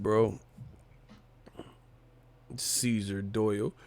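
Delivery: normal talking voice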